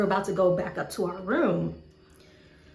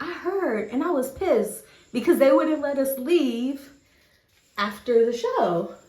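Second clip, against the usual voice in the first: a hint of [name] annoyed; 5 semitones higher, 5 dB louder than usual. [name] voiced irritated.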